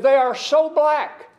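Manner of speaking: imitated amused exclamation, stretched out; retold amused marveling. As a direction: marvel at it slowly and emphatically, as they did. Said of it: "but they were laughing".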